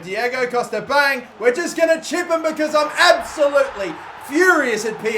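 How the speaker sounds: high, loud gleeful shout; excited call. Real, not acted. Gamer gloating while scoring a chip, excited. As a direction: gloat as you chip him. Chipping the keeper.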